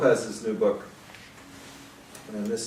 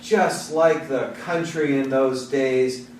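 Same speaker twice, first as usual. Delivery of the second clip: measured, rhythmic poetry reading; poem reading; 4 semitones higher, 3 dB louder, slower than usual. Acted. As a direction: read it as a poem to a live room. Reads his poem aloud.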